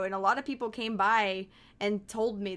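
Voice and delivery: usual speaking voice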